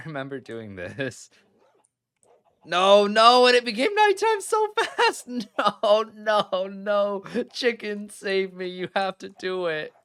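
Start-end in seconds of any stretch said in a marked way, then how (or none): 2.6-7.2 high, loud panicked shrieks of no; panicked screaming. Real, not acted. Gamer watching mobs swarm her, shrieking in panic. 7.5-9.9 high, frantic plea to a chicken; desperate pleading. Real, not acted. Player begging her chicken to save her, high and frantic.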